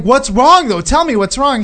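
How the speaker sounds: high-pitched worried insistence; retold urgent pleading. Him pleading on the dream phone call, urgently.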